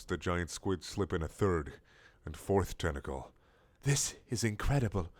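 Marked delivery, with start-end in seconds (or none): 3.8-5.1 breathed. Acted. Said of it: "Hogwarts breathed".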